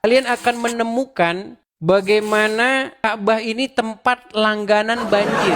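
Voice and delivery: ordinary delivery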